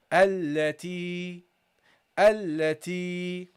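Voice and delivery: التي slow, doubled lam, long i; teacher modelling التي; slow recitation. Shown as a demonstration. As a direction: stretch the ya in التي. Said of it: "that's how you pronounce it".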